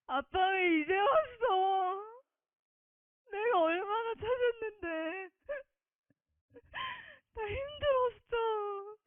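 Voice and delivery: 높고 느리게, 울면서 떼쓰듯 아빠를 부름; 다섯 살 아이처럼 흐느끼며 투정; 울먹이는 어리광. Retold or imitated. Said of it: "되게 버르장머리 없이 그랬어요". (울며 아빠에게 떼쓰는 어리광)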